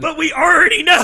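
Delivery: high, loud exasperated shout; worked-up, high-pitched yell; angry protest. Real, not acted. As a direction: shout it back in riled-up protest. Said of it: "[name], you're angry right now".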